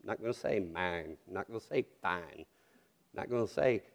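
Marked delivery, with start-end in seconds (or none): 0.6-1.2 "mine" with a flattened Southern vowel, near "man"; low, soft Southern "mine"; old Southern accent. Shown as a demonstration. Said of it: "I'm gonna change it". 2.0-2.4 "fine" with a Southern flattened vowel; low, soft Southern "fine"; old Southern accent. Shown as a demonstration. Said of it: "I'm gonna change it".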